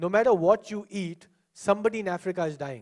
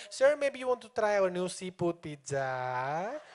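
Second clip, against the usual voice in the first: slow, drawn-out singsong pitch; mock seductive phone-sales drawl; salesman's odd tone; 5 dB quieter, slower than usual. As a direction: stretch the last word into a long swooping curl. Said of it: "with this tone".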